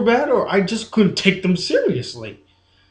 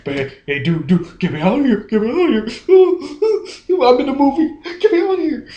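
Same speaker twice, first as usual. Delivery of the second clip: joking around; 5 semitones higher, faster than usual. Retold or imitated. Mocking a trapped actor who seems to be joking, laughing fake plea. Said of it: "he was joking around with him".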